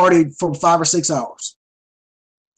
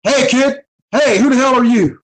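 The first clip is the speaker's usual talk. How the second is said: put-on a gruff, higher, louder Ric Flair snap at the kid; mock tough-guy challenge, pitched up; imitating Ric Flair; 7 semitones higher, 3 dB louder than usual. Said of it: "And Rick says".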